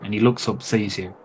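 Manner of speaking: normal talking voice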